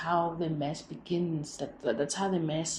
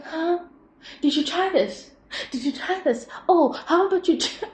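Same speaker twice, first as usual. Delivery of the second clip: high, eager helpful voice; helpful driver voice; 10 semitones higher, 5 dB louder than usual. Retold or imitated. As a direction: brightly offer fixes, higher. Imitating driver offering solutions.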